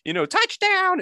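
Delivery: high, pinched, nasal sports-mom voice. Retold or imitated. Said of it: "voice is just like".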